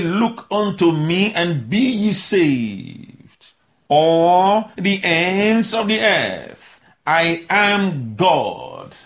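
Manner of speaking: very slow, weighty proclamation; emphatic. Acted. Preacher declaring God's words, very slow emphatic. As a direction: stretch each word out like a decree. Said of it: "That's emphatic".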